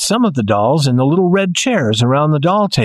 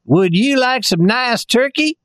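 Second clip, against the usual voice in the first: lightly voiced toy soldier, higher; a touch of a polite toy soldier; tin soldier voice; 10 semitones higher than usual. A narrator's reading. Tin soldier offers turkey.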